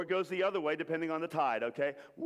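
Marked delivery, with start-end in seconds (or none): 2.2-2.3 swooping wave "woo"; long, sliding "woo"; rising "woo" for the wave. Shown as a demonstration. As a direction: whoop upward like a crowd doing the wave. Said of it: "we're gonna do the wave".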